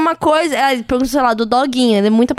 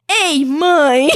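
small son's cheeky protest, drawn out; Valentim. Retold or imitated. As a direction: imitate a little boy answering back to mom. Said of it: "Ele fala assim".

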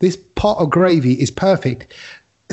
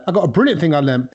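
ordinary delivery

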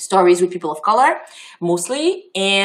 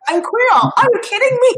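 higher, louder disbelieving comeback; retold indignant retort; 4 dB louder than usual. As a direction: act out your own shocked reply. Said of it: "I'm like".

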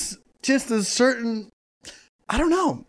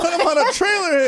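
laughing yell; 9 semitones higher than usual. Real, not acted. Wild joke, cracking up.

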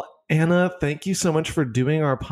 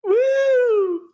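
high whoop; cheering; 4 dB louder than usual. Real, not acted. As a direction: cheer loudly for the speaker. Cheering a shout out.

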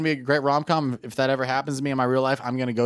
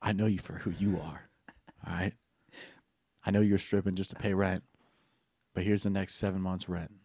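smooth quiet; 7 semitones lower, 10 dB quieter than usual. Acted. Smooth hero speech to stripper.

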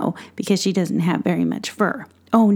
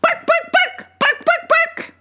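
high squeaky little dog yaps; yappy dog bark; 3 dB louder than usual. Acted. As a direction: yap like a tiny dog. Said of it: "she always goes like this".

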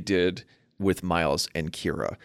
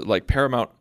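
normal talking voice

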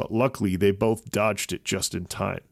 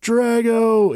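high, loud alarmed cry; 7 dB louder than usual. A narrator's reading. Avon seeing Drago hit, loud alarmed cry. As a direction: call out to a hurt friend. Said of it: "Avon cried".

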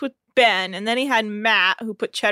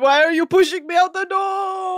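mock protest; 8 semitones higher, 3 dB louder than usual. Host as departing Rob objecting to being pushed out, wailing. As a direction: cry out as if being shoved away.

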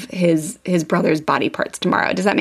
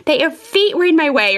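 mocking apology; 8 semitones higher than usual. Boy mocking mom's demand to apologize, exaggerated fake sorry. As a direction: imitate a bratty kid's fake apology. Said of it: "then he started mocking her".

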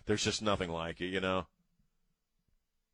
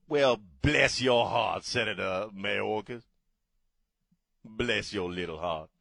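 mock Southern condescension. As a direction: pity them condescendingly in a Southern accent. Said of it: "I almost wish the hell he'd go".

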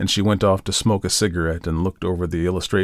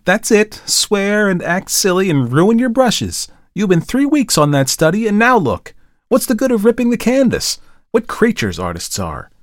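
much higher and louder scolding; scolding tirade; 12 semitones higher, 6 dB louder than usual. A narrator's reading. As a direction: tear into him for ruining the brushes. Model scolding the painter.

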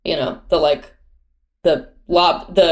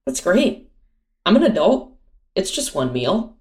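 sweetly passive-aggressive; 4 semitones higher than usual. Retold or imitated. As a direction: act fine while clearly annoyed. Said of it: "my worst trait is probably passive aggression".

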